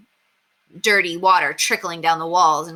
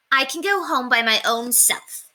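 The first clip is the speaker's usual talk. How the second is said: put-on little girl voice; 7 semitones higher than usual. Ramona insists.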